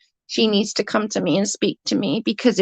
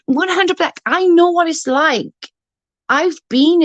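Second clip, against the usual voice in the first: higher and louder, firm and eager; emphatic agreement; 7 semitones higher, 4 dB louder than usual. Real, not acted. Agreeing with a commenter about being in need, emphatically. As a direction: say it like you've lived it.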